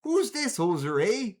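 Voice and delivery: hammed-up Canadian accent; cartoonish Canadian accent. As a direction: put on a broad Canadian accent, Minnesota-tinged. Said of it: "That's why I went for the accent".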